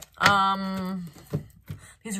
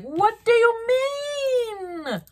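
very slow, much higher, crying-out disbelief; mock overwhelmed cry of shock; hammed-up shocked wail; 5 dB louder, much slower than usual. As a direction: wail it like you can't believe it. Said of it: "You're like".

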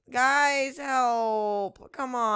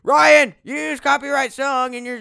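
shouting; 5 semitones higher, 10 dB louder than usual. Retold or imitated. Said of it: "Everyone's gonna, like".

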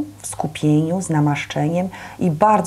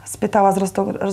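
ordinary delivery

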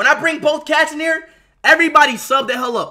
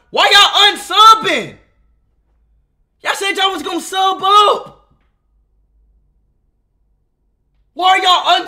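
higher, louder outraged shouting; angry yell; 6 semitones higher, 5 dB louder than usual. Real, not acted. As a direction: yell at chat for unsubbing. Yelling at chat unsubbing.

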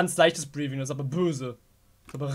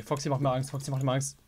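ordinary talk, nothing marked

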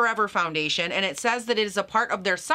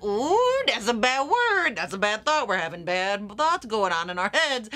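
mock self-scolding; slower than usual. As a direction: playfully scold your own bad thoughts. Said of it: "I start thinking, like".

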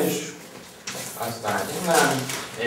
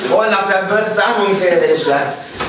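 loud stern announcement; 7 semitones higher, 6 dB louder than usual. Acted. Teacher announces the test.

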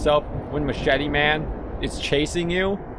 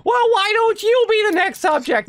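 much higher put-on voice for Lucy; Lucy's voice. Gamer voicing Lucy asking back at him. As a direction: squeak it out as snippy Lucy. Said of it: "And then Lucy's like".

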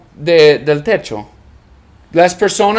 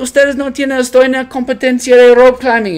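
put-on drunk arguing; 9 semitones higher, faster than usual. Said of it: "discutiendo con los bartenders".

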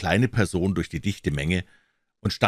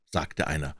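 ordinary delivery, nothing marked

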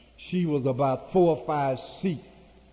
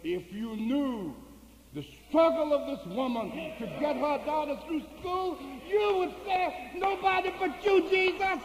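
rising exhortation; 12 semitones higher than usual. Retold or imitated. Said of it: "he said to that congregation".

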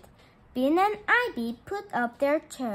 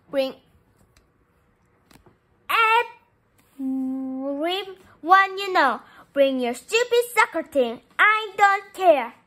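angry dare; 3 semitones higher, 7 dB louder than usual. Acted. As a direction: spit out the challenge. Bean furious, daring Leo.

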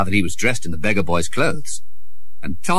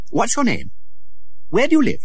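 lightly voiced curious prince; 14 semitones higher than usual.

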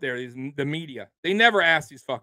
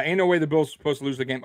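ordinary talk, nothing marked

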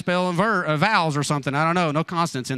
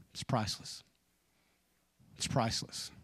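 whispered, much softer; hushed whisper; 4 semitones lower, 11 dB quieter than usual. Real, not acted. Preacher tenderly telling the congregation his monkey is priceless, whispered. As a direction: breathe the word like a secret.

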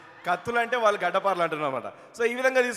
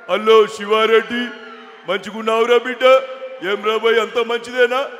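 louder, slow politician impression; Hanumantha Rao impression; 7 dB louder than usual. Hanumantha Rao greeting Siva Reddy at home, louder and slow. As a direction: greet him like the old politician. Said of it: "Hanumantharao గారి bit".

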